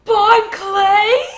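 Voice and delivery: high, loud laughing yell of surprise; real excited outburst; shocked shout. Real, not acted. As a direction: scream the name in delight. Shock at Bon Clay appearing.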